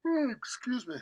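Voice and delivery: yawning through the words; mid-yawn, mouth stretched. Real, not acted. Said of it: "I don't know why I'm yawning".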